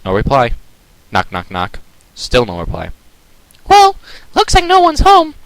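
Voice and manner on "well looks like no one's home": Pinky voice. Acted.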